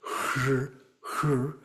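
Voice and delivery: "hr" as one breathy sound; blended H and R. Shown as a demonstration. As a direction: blend h straight into r for "hr". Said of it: "blended together".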